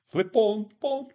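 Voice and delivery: "Flip phone" in a loud, very high mocking squeak. Speaker mocks own slip on the word, mock squeak.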